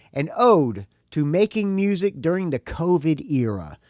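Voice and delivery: upbeat promo read; press release read. Acted. Reads the label's press release.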